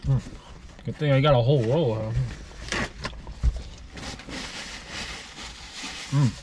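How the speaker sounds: chewing while talking; talking around food; mouth full. Real, not acted. Seen 0: Bites chicken at -2; cheeks and lips show chewing while wiping mouth.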